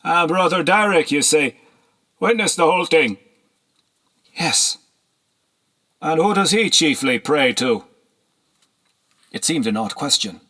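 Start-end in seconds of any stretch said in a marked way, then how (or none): 0.0-7.8 higher, louder, probing; sly nobleman. A narrator's reading. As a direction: fish for information slyly. Horseman probes about Derek.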